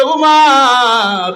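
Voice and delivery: long, high falling call. Acted. An ordinary worshipper hailing Umar, drawn-out call. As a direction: hail him from the crowd, stretching the name. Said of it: "ডাক দিয়ে বলে".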